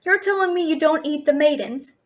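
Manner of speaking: normal talking voice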